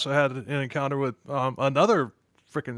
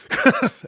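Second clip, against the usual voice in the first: laugh; 10 semitones higher, 7 dB louder than usual. Real, not acted. Laugh mid-question.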